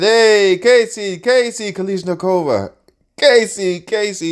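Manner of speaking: loud, high call to Casey. Retold or imitated. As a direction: hail him across the room. Russian calling out to Casey.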